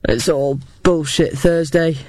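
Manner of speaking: put-on gruff, gravelly old veteran. A narrator's reading. Cafe owner grumbling to Thursday close at hand, gravelly. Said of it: "said a gravelly voice".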